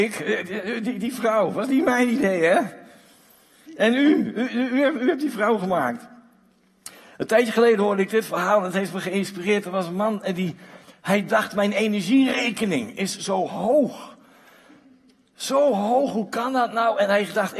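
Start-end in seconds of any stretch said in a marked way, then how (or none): 0.0-6.1 flustered, laughing, pitched up; mock stammering Adam. Adam stammers to God that the woman was not his idea, laughing. 11.5-17.0 slow, higher, rising in disbelief; so high, how can that be; mock outrage. Man staring at his energy bill in mock disbelief.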